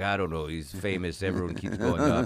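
lightly voiced waiter with a thick Eastern European accent. Waiter telling a diner about a famous guest, Eastern European accent. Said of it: "That was a good impression".

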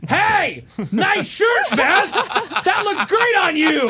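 high, loud laughing yell. Pretend. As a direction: shout the compliment across the office. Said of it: "What if you yell it at people?".

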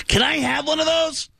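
very high pleading rocker voice; squeaky, hopeful ask. Retold or imitated. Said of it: "He goes".

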